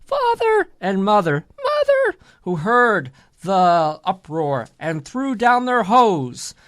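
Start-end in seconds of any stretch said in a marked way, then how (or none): 0.0-0.7 high-pitched mock call for father; crying out. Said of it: "Crying out to his father". 1.6-2.2 high-pitched mock call for mother; crying out. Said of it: "Crying out to his father and mother".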